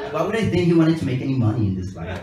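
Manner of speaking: usual speaking voice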